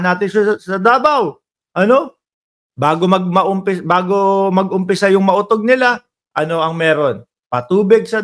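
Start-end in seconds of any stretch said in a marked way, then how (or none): none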